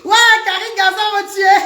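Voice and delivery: high, laughing outburst; amused exclamation. Real, not acted. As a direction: cry out in high-pitched amusement. Laughing exclamation at viewer's comment.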